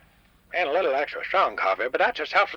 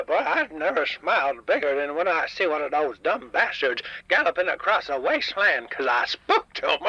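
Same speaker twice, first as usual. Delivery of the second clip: ordinary delivery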